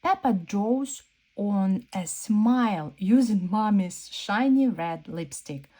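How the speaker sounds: usual speaking voice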